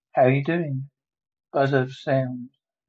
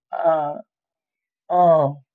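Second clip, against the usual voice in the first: sudden fright; 8 dB louder than usual. Acted. Grabbed during interrogation.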